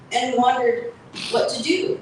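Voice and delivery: ordinary delivery, nothing marked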